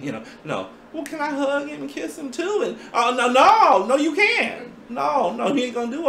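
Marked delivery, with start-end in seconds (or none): 0.9-2.6 high, coy asking voice; girlfriend voice. Pretend. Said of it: "girlfriend asks, acted". 2.7-6.1 high, loud, laughing no; wife refusing the friend, high and laughing; emphatic refusal. Pretend.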